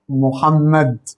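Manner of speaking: محمد drawn out very slowly; a very slow, drawn-out answer. Shown as a demonstration. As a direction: stretch محمد as the answer to the class. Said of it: "مين اللي جاء؟".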